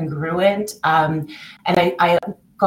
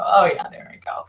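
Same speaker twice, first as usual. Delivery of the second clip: whispered wry aside at yourself; a whispered chuckle at oneself; self-mocking whisper. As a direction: whisper it, catching your own habit. Laugh at myself now and go.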